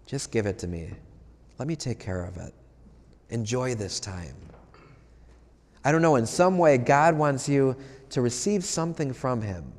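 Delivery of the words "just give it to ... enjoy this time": gentle voice of God. Acted. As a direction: lower and softer, gently handing over a burden. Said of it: "God wants to say to you".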